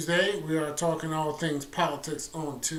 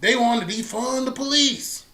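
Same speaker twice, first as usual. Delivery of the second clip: high, slow sneering mimic of the other side; mocking impression; 10 semitones higher, 4 dB louder than usual. Host mocking critics of the radical left, high and slow. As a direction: lift the voice way up and drag it out to mock them. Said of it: "they were the main one talking about some".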